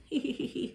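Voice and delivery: soft, short acted giggle; little hee-hee giggle. A narrator's reading. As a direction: start to titter quietly. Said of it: "He began to giggle".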